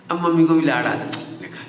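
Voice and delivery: normal talking voice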